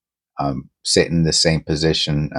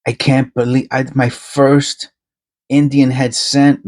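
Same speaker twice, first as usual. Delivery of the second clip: higher and slow; awed excitement; 6 semitones higher, slower than usual. Real, not acted. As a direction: savour each word. Marveling at first Indian head.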